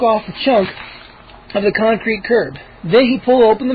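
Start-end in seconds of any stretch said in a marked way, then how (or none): none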